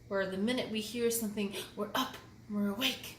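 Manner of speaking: ordinary delivery, nothing marked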